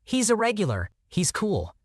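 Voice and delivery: counterman's quote. A narrator's reading. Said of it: "My guy looks at him and says".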